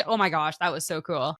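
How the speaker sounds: ordinary delivery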